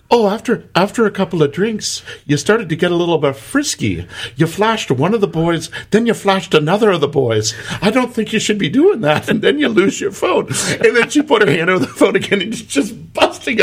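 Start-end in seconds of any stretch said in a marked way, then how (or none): 0.1-10.5 high, sweetly scolding Irish voice; mock-motherly Irish lecture. Bartender scolds the girl over the phone in an Irish accent. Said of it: "she goes".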